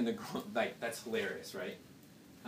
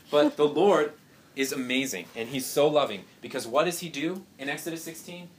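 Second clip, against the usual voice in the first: ordinary talk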